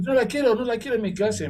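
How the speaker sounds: loud, high, firm no la quiero; insistent refusal. Retold or imitated. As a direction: refuse her flatly to the minister.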